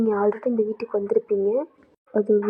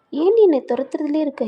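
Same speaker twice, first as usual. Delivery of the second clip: exasperated outburst; 6 semitones higher, 5 dB louder than usual. Acted. Jeff pushing why she sends him.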